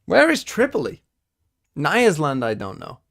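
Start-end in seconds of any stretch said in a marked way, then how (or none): 0.0-1.0 much higher and louder, shouting at the screen in frustration; exasperated shout. Real, not acted. Frustrated at the map quiz. 1.8-2.4 exasperated outburst. Real, not acted. Blurting the place name.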